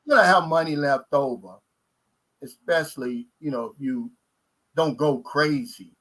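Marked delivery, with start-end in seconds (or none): none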